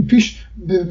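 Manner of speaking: ordinary talk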